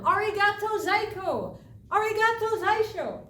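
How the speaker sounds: loud, high call to the rocks. Acted. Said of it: "she called out to them".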